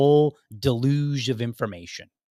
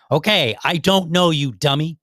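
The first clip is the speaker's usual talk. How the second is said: raised, louder voice, exasperated; higher, louder exasperated comeback; angry retort; 4 semitones higher, 5 dB louder than usual. Retold or imitated. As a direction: retort loudly, letting real irritation slip in. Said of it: "Sorry, I don't mean to be so angry".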